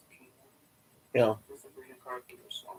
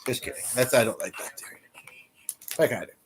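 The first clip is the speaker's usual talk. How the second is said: whispering; 4 dB quieter than usual. Real, not acted. Muttered aside while eating.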